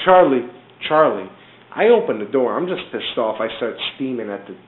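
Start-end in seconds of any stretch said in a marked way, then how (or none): 0.1-1.3 loud, urgent holler of a name; retold calling out. Neighbor running upstairs after Charlie, loud mock call. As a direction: call out loudly, imitating the neighbor.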